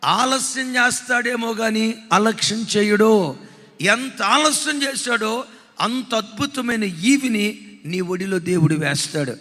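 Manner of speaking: loud, slow pulpit proclamation; preaching at full force. Acted. Preacher proclaiming to the congregation, slow and loud. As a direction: thunder each promise out to the congregation.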